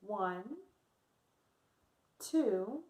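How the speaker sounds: calm the class down with each count; guided breathing. Acted. Leads breathing exercise.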